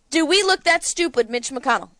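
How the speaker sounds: normal talking voice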